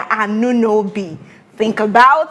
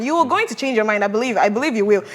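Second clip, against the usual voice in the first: ordinary delivery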